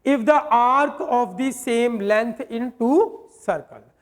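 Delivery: reading the question word by word, very slow; slow dictation reading. Shown as a demonstration. Teacher reads the exam question to students, very slow. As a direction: spell out each word of the question slowly. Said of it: "अब वो बोल रहा है".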